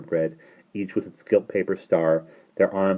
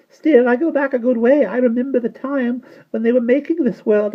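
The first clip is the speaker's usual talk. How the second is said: put-on very high, louder little-old-woman voice; tiny old shopkeeper; 8 dB louder than usual. A narrator's reading. Old shopkeeper boasting to the children, high and chatty.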